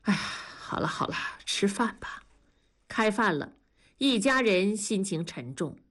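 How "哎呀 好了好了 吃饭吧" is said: sighing, softly giving up; helpless, resigned. A narrator's reading. Wenli calling an end to the family argument, resigned. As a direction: drop the argument with a weary hush. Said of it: "文丽无奈地说".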